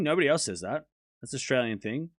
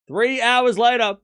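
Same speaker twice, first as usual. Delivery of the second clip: slow, high, loud time-card voice; mock announcer; 6 semitones higher, 6 dB louder, slower than usual. Telling chat of the long delay, mock announcer. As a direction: draw out the announcement.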